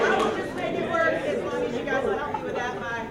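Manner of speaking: projecting loudly without a mic; booming to a hall with no mic. Real, not acted. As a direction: fill the room with your voice. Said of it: "I've got a loud voice".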